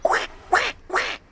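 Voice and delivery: high, breathy toy duck quacks; duck quacking. Pretend. Showing children the duck's sound, high quacking. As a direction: quack like a squeaky little duck. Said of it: "what do you think we heard it say".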